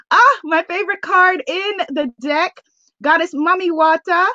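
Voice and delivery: usual speaking voice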